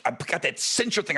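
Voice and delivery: normal talking voice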